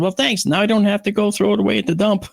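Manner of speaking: wry, put-upon thanks; sarcastic thanks. Retold or imitated. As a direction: thank the garbage thief with heavy irony. Mock thanks to thieving bear.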